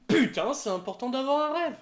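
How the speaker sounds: loud high quote; quoting a character. Retold or imitated. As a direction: shout it like the anime line. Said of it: "comme a dit Réponse".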